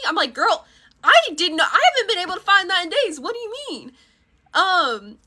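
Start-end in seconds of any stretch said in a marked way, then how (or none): 0.3-3.9 bewildered. Retold or imitated. Her bewildered denial, retold.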